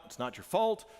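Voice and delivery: ordinary delivery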